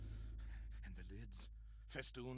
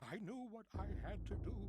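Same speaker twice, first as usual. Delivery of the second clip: frantic; 9 semitones higher, much faster than usual. Acted. Madman confessing in frenzy.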